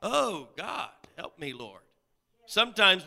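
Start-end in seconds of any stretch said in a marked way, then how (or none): 0.0-1.8 mock surprised churchgoer. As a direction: act mildly startled, then falling into prayer. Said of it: "we're surprised when we come to church".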